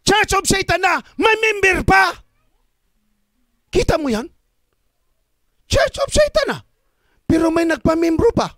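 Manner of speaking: high, loud, shouted preaching; fiery pulpit cry over a growing satanic church; preaching at full force. Acted. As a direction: thunder it at the congregation. Preacher exclaims to congregation.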